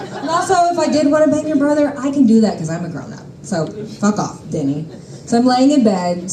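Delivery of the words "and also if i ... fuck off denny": indignant rant. Real, not acted. Ranting at absent Denny.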